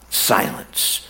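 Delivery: whispered slowly, almost no voice; hushed whisper. Shown as a demonstration. Preacher lets John's unanswered prayers hang, whispered. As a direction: breathe the word Silence as a soft whisper. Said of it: "But silence".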